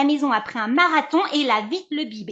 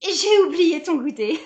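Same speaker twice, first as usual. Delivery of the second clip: higher, laughing it off; embarrassed laugh; 8 semitones higher, 6 dB louder than usual. Acted. Mother admitting to her son she forgot his snack, laughing. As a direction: laugh guiltily through the admission.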